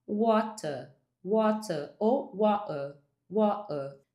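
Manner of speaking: water in a British accent, no R at the end. Shown as a demonstration. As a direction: say water the British way, dropping the final R. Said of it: "eu falaria".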